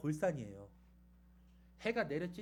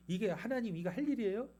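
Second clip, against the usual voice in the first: higher challenge thrown at God; arguing back; 5 semitones higher, 4 dB louder than usual. Retold or imitated. Jonah takes God to task over sparing the city, arguing. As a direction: protest indignantly, pitch climbing. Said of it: "막 따지는 거예요".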